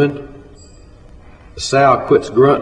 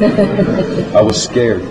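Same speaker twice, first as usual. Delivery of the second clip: ordinary delivery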